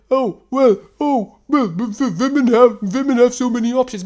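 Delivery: high, loud, stammering whine; mock their excuse with a stuttering yelp; mock complaining guys. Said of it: "And then you're like".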